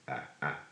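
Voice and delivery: soft short open ah, ah; quiet open ah, ah; saying ahh. Shown as a demonstration.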